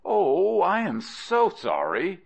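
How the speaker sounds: high-pitched, drawn-out oh of regret; overdone sorry, pitched well up; feigned apology. Acted. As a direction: lay on the apology, starting loud and high. Friend feigns apology for sold plough.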